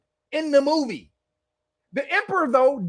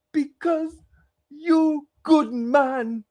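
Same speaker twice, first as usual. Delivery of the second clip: slow, drawn-out broken English, the last line louder; mock dying woman; 4 semitones higher, 4 dB louder, much slower than usual. Marco Polo's dying companion, hammed up and slow. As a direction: drag out her dying words in mock pidgin. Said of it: "She said".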